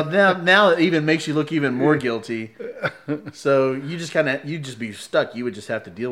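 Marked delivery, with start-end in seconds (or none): none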